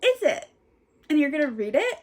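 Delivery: a hint of an excited therapist. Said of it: "she went".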